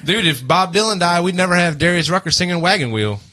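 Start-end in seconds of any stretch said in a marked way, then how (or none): none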